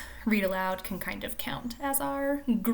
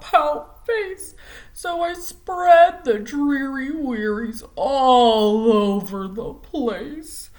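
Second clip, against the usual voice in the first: slow, louder, mopey singsong refrain; gloomy pout fish; 3 semitones higher, 10 dB louder, much slower than usual. Acted. Teacher reads the pout fish's gloomy refrain, slow and loud. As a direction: drag out every word like a sulking fish.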